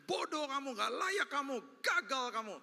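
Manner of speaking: high, sneering accuser; accusing devil. Retold or imitated. The devil accusing a fallen believer, high and sneering. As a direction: hurl the insults down. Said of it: "Suara yang pertama paling keras".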